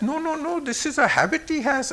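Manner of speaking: high, soft hurried-in denial; earnest protest. Retold or imitated. Sheriarji explains his friend's habit to the accusers, protesting.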